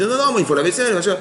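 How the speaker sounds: a light, hammed-up wife voice; mock stubborn wife. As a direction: parody her childish refusal. Mocks wife choosing the dishes.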